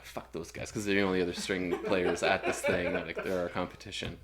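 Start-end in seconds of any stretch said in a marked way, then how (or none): 0.0-0.7 muttered aside. Retold or imitated. Muttered aside about rival players.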